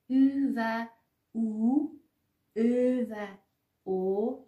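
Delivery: او said as ü, u, ö, o, each set apart by pauses; vowel contrasts. Shown as a demonstration. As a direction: demonstrate each او separately: ü and u, then ö and o.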